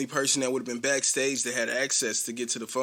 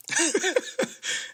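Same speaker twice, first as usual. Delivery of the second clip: real high-pitched chuckle; high laugh. Real, not acted. Amused while recapping the scene, high laugh. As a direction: let a squeaky laugh slip.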